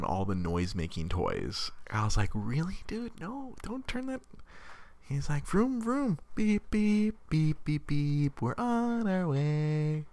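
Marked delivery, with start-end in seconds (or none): 2.3-4.2 mock exasperated plea. Mom pleading with toddler. 5.5-10.1 put-on high, slow little kid making car sounds; sing-song vroom and beep like a toddler playing; a small boy voiced high and drawn out; toddler car noises. Mimicking toddler's toy noises.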